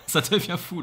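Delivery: laughing out the words. Real, not acted. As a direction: chuckle through it. Laughs while saying.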